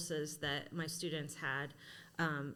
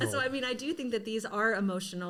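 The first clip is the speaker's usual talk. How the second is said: louder, higher words riding a laugh; 5 semitones higher, 6 dB louder than usual. Real, not acted. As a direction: talk through a real laugh, pitch jumping up. Laughing after the park story.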